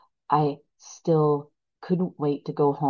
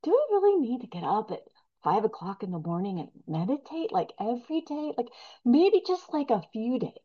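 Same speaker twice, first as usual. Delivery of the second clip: higher, wheedling voice of my ego; lightly voiced sly inner ego, higher; coaxing ego; 7 semitones higher, 3 dB quieter than usual. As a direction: tempt yourself to skip the work. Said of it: "my ego starts going".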